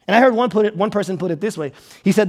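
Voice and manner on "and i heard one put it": usual speaking voice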